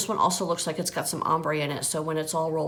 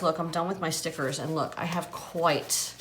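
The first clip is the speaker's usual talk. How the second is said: normal talking voice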